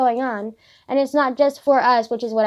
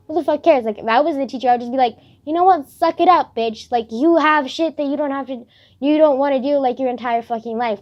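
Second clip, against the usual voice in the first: loud, raised-pitch telling-off; exasperated scolding; 4 semitones higher, 6 dB louder than usual. Acted. Imagined teacher to whining choir kids, exasperated scolding. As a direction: lay into them like a fed-up teacher.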